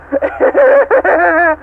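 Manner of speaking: short high mocking cackle; evil cackle. AM mocking the damned humans, cackling. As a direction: cackle at the joke. AI gloating over captives.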